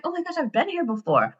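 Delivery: high, soft, wide-eyed gasp of déjà vu; amazed recognition. Retold or imitated. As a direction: replay the shock of knowing the place. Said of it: "So I'm looking around like".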